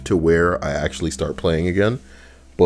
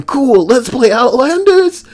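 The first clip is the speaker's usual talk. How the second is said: higher, slower, upbeat "Cool, let's play Outlanders."; mock their eager intro; imitating themselves; 6 dB louder than usual. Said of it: "us going".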